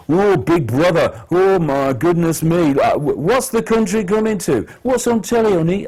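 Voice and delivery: high-pitched imitation of moaners; mock moaning. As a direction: moan it mockingly, like helpless grumblers. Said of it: "but they're moaning about it".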